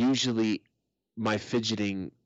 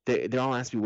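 ordinary talk

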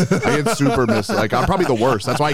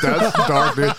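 high burst of laughter; laughing; 5 semitones higher, 3 dB louder, slower than usual. Real, not acted. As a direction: laugh through the words. Laughs at dark joke.

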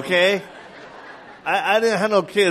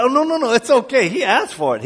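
high, eager denial; excited protest; 8 semitones higher, 4 dB louder than usual. Retold or imitated. Preacher excusing himself to the older pastor, eager. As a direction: brush it off eagerly.

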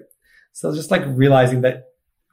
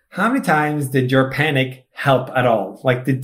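usual speaking voice

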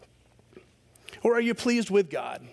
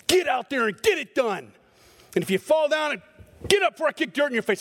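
tough coach; 6 semitones higher than usual. Retold or imitated. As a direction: push them like a drill coach yelling from the sideline. Drill-coach demands.

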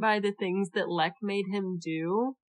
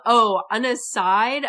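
ordinary talk

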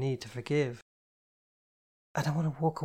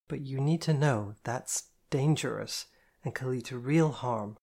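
usual speaking voice

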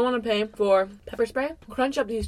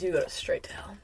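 ominous whispered warning; 4 dB quieter than usual. Pretend. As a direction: whisper it darkly.

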